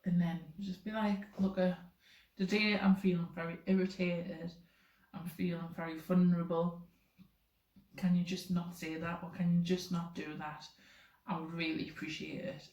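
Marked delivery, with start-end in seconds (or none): 1.4-12.7 measured, softly ending request; calm approach. Shown as a demonstration. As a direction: state your irritation calmly and evenly. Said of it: "Instead of being like".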